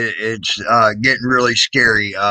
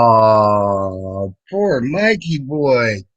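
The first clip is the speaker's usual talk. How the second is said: slow, drawn-out fake sympathy; mock pity; 2 semitones lower, much slower than usual. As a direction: pity him mockingly, stretching each word. Mocks a whining clip.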